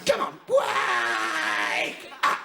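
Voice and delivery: high, very slow stretched-out yell; wake-up shout. Acted. Captain wakes hungover sleeper.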